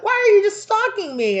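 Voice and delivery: very high, loud complaint; followed around at a haunted house; startled complaint. Pretend. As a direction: yelp it, spooked.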